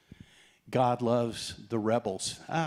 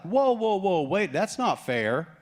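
high, loud indignant whoa; protesting whoa; 7 semitones higher, 4 dB louder than usual. Retold or imitated. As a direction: cry foul like a wronged brother. Brothers protesting unfair pay.

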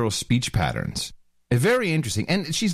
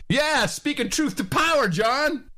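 high mocking cheer, name shouted; 12 semitones higher than usual. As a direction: hype it up sarcastically.

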